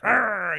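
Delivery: imitated feral cat growl, drawn out; mock angry cat "arrr"; cat fight snarl. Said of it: "expecting these cats to come out and be like".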